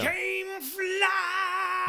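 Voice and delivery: high, loud, long-held cry; drawn-out shout. Pretend. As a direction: bellow it and stretch it right out. Mock-announces a name over the hosts.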